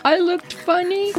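very high, hurt, wobbly question; hurt little sister. Retold or imitated. Sister asking if she looked funny, high and hurt. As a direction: ask it small and wounded. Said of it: "She's like".

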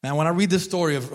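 ordinary talk, nothing marked

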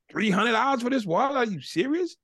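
high, stunned disbelief; can't believe the price; dad questioning a pricey wallet; incredulous. Retold or imitated. Dad confronts son over wallet.

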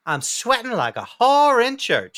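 lightly voiced prim old lady; imitated old woman. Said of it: "I heard an old woman say".

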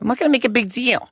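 high, fast mom voice; imitating mom. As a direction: rattle it off as a high-pitched mom. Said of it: "She's like".

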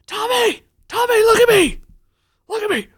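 high, loud barking coach, mock fury; angry coach yell. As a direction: imitate a coach yelling at a kid. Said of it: "the coach comes out and says".

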